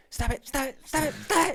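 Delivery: high, rising frantic teacher voice; teacher spazzing out. Retold or imitated. Classmate imitates teacher losing it, high and frantic. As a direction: snap it like a frazzled teacher. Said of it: "how did you do that voice so well?".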